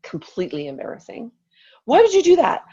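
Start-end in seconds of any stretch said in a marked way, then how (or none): 1.9-2.6 high-pitched indignant tirade, starting loud, easing in volume; acted exasperation, voice pitched way up; retold over-the-top rant. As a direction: re-enact an overblown marital scolding. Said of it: "And I completely went there".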